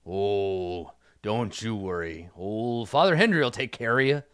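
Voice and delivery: folksy, unhurried character voice; Father Hendry. Acted. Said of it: "Hendry says".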